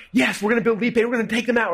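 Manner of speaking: high, eager retold yes; all excited. Agreeing to take on the rival, excited. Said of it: "We're, like, all excited".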